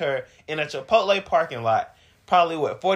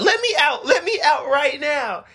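demanding yell; 12 semitones higher than usual. Retold or imitated. Girlfriend demanding out during fight.

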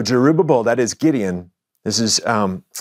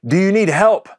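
lightly hammed-up, higher-pitched call; mock call across the water, voice pitched up; 6 semitones higher, 3 dB louder than usual. Fishing boat hails a stranded man, calling out. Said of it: "comes near and calls out".

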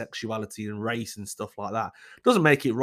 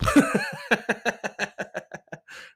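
laugh; 9 semitones higher than usual. Real, not acted. Laughs at her own joke.

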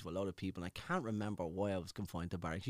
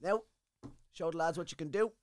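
high, raised barked decree; mock sergeant's orders; 11 semitones higher, 5 dB louder than usual. Sergeant major renaming him on parade, high, mock-commanding. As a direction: bark it out like a drill sergeant handing out a nickname. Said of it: "he goes".

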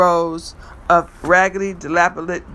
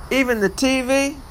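high, eager question; surprised; 7 semitones higher than usual. Acted. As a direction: ask it in eager disbelief. Excited disbelief.

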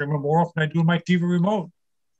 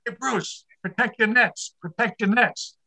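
higher-pitched imitation of a yelling teacher; retold teacher's yell; 7 semitones higher, slower than usual. Swim teacher yelling to a pupil in the pool corner, mock yell. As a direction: call out like a gym teacher, without real volume. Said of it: "the teacher would be yelling".

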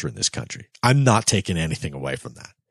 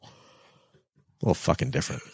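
muttered aside; 6 dB quieter than usual. Real, not acted. Pointed sarcastic aside.

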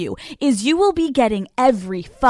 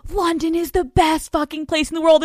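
high, gushing imitation of everyone; mock gushing; 6 semitones higher than usual. Everyone raving to her about London, mock gushing. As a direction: parrot their raving in a raised voice. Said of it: "Everyone I'm talking to is like".

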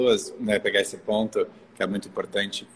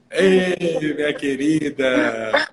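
high, loud, stretched-out hello; delighted greeting; 6 semitones higher, 6 dB louder, much slower than usual. Real, not acted. Host greets her dear guest, delighted drawn-out greeting. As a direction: sing out an affectionate welcome.

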